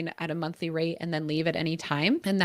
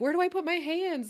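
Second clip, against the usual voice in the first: higher, lightly voiced flustered movie character; Talladega Nights quote; 10 semitones higher than usual. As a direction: quote the awkward racer from the movie. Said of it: "it was like Talladega Nights".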